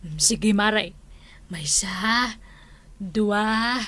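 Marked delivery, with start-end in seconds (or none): 1.5-3.8 whispered counting one, two; hushed count. Acted. Comadre counting down before the trick, whispered. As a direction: count it down under your breath.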